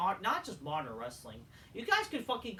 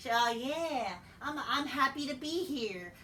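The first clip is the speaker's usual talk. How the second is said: high-pitched put-on voice; exaggerated high mock voice; mocking impression of a wrestler; 7 semitones higher, slower than usual. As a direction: mimic him in a high, mocking tone.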